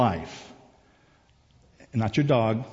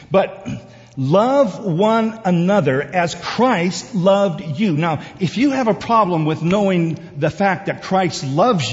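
higher, emphatic, drawn-out proclamation; impassioned preaching; 7 semitones higher, 6 dB louder than usual. Real, not acted. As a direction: press the truth home to listeners. Preaching at full force.

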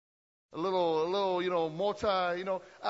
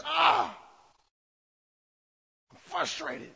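frustrated Gideon; 6 semitones higher, slower than usual. Retold or imitated. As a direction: cry out in frustration. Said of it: "he sits back and he says".

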